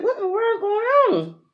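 high-pitched, alarmed disbelief; bewildered outcry. Retold or imitated. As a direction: cry out in confusion, voice way up. Retold bewilderment at hospital.

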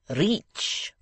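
put-on "Reach" with a long ee, French-accented. A narrator's reading. Foreigner repeating the word.

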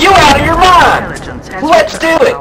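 high, loud, slow excited yell; excited shout. Retold or imitated. Answering Keith's crazy firework idea, excited loud yell. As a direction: shock turning into glee. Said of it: "and I looked at Keith".

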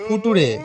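"ফুটুরে" read as spelt, fu-tu-re; wrong pronunciation. Acted. Bluffing teacher dictates "ফুটুরে" for future, mispronounced. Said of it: "উচ্চারণ ভুলভাল বলছে".

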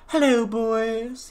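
high, slow, regal drawl; queen voice. Acted. Queen greeting the boys in a high, slow voice. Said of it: "my voice is very much like this".

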